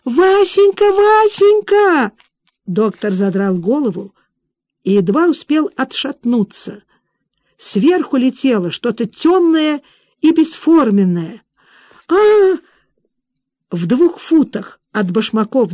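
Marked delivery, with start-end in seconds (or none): 0.0-2.1 drawn-out wail, much higher and louder; heartrending wail. Acted. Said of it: "Надрывные вопли". 12.1-12.6 short shriek, much higher and louder; falling scream. Acted. Woman falls from window.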